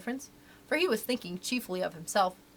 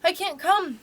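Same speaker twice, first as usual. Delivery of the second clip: high, louder apologetic refusal; 8 semitones higher, 6 dB louder, slower than usual. A narrator's reading. Wendy turns down Peter's invitation, apologetically. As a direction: plead an excuse, pitched up. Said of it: "she said apologetically".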